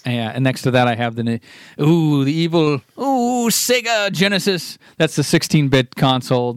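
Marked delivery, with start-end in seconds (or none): 1.8-4.8 slow, higher, spooky mock menace; mock sinister. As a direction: drawl it out like a villain's name. Mocks the rival console as evil.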